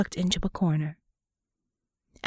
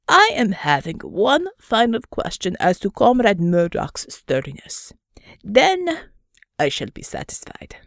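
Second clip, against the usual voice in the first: put-on loud, heavy Russian-accented bear; 3 dB louder than usual. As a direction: lean into a thick Russian accent. Pavel, Russian bear, speaking.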